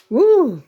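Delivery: high, loud "woo"; real exclaimed woo. Real, not acted. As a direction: let out a sudden high whoop of effort. Exertion while fumbling with her glasses.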